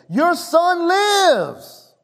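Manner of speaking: high, drawn-out proclamation; jubilant announcement. Acted. Servant meeting the father with news, high excited call. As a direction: call out the good news, stretching it.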